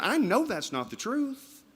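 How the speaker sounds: slow, high-pitched acted retort; insistent retort. Acts out Ahab challenging the prophet.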